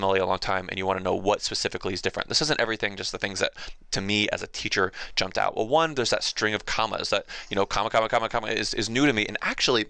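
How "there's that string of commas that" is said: ordinary talk, nothing marked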